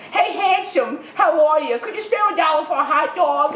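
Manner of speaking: high-pitched beggar woman's come-on; imitating Bullethead. Ferry woman asking passers-by for a dollar, high and wheedling. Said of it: "she talks like this all the time".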